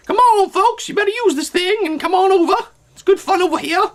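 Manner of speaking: lightly Southern folksy pitch; a hint of a Southern salesman; Southern accent. Jobs inviting folks over, a light Southern accent. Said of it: "I don't know why I'm Southern".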